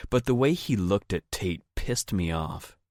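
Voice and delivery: plain reading voice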